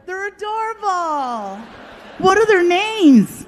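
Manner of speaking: high, squealing rush of apologies and delight; gushing barista. Retold or imitated. Comic telling of the barista squealing over his dogs. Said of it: "and she's like".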